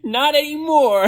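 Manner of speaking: high shouted retort. Acted. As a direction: shout it back over your shoulder. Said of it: "I shouted back".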